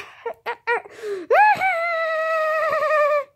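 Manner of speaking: high, loud evil cackle; evil laugh. Pretend. Demon gloats at the hero with an evil cackle. Villain laughs after reveal.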